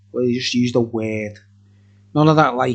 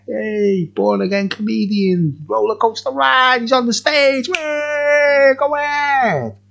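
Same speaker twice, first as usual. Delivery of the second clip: climbing higher and higher like a show host; mock announcer hype; 9 semitones higher than usual. Reviewer introducing himself as the comedy hour act, mock announcer build-up.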